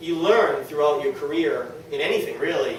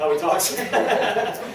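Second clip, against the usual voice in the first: laughing; 4 semitones higher, much slower than usual. Real, not acted. Laughing to stop crying.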